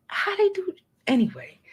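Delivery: ordinary delivery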